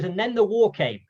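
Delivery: a hint of Hal Roach announcing the next chapter; old man dragging his Oscars speech on, lightly voiced; little high voice. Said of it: "this little high little voice".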